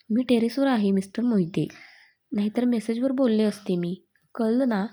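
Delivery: ordinary delivery, nothing marked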